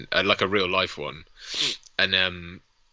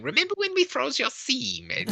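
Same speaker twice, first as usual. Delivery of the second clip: high mimicked voice, breaking into laughter; imitated film woman; 10 semitones higher, 4 dB quieter than usual. Quoting the film's woman, high mimicking voice. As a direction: mimic her, pitched well up. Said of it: "hard cuts to her going".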